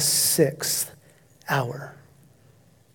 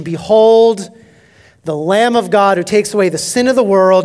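proclaimed declaration; 3 semitones higher, 6 dB louder than usual. Acted. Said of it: "that declaration".

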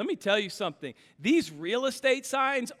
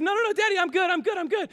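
put-on much higher little boy saying he's fine; child reassuring; 12 semitones higher, 4 dB louder, much faster than usual. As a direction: chirp it like a dazed little kid. Son voiced in story.

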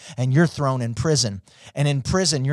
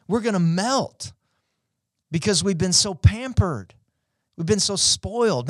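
higher, urging the crowd on; rally them to do better; fired-up preaching; 5 semitones higher than usual. Real, not acted. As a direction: pastor pushes congregation, fiery preaching. Preaching at full force.

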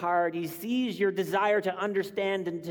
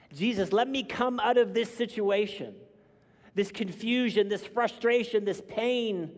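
mildly urgent plea, pitched high; pleading prayer; 6 semitones higher, 3 dB louder than usual. Acted. Praying to Jesus to be let out of struggle, pleading. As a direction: plead upward, item after item, voice raised.

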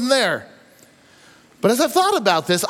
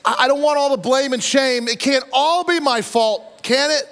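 voiced Pharaoh plea; 6 semitones higher than usual. Preacher voicing Pharaoh's plea.

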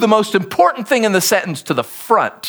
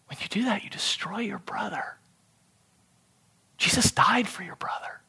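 whispered, softer rebuke; solemn whispered warning; intense whisper; 13 dB quieter than usual. Acted. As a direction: whisper it straight to one person. Paul's words spoken to Jeff.

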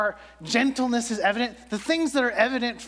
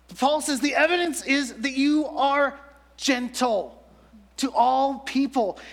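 higher, louder and slow, pressing each word; emphatic preaching; 6 semitones higher, 4 dB louder, slower than usual. Real, not acted. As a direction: drive the point home to the congregation. Preaching climax on gentleness.

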